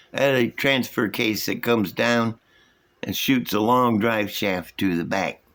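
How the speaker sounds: usual speaking voice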